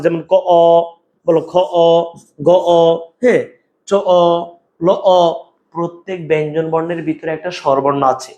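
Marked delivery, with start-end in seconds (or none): 0.3-2.8 "ক, অ" split into consonant and open vowel; inherent vowel shown. Shown as a demonstration. Teacher showing students each consonant's hidden vowel, split out. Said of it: "ক এর ভিতরে একটা অ আছে". 3.9-5.2 "চ, অ" and "ল, অ" split in two; inherent vowel shown. Shown as a demonstration. Teacher showing students each consonant's hidden vowel, split out. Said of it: "ক এর ভিতরে একটা অ আছে".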